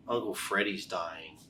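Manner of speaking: bummed-out family, softly imitated; really sad. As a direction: break it gloomily, quiet and drawn out. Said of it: "they're all really sad".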